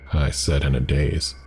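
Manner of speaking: ordinary delivery, nothing marked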